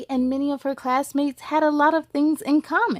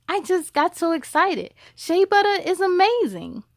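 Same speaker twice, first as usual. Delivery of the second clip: excited gush; 6 semitones higher than usual. Acted. Classmate apologizing excitedly.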